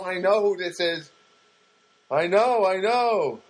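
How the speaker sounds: exasperated. Retold or imitated. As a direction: cut off a tiresome caller, fed up. Cutting off the slow caller.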